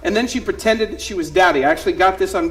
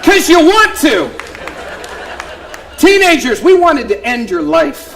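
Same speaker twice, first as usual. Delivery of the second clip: loud, higher comic rant; 7 semitones higher, 5 dB louder than usual. Acted. Comic ranting to parents about teenagers, loud. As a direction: yell it at the parents. Stand-up punchline yelled at crowd.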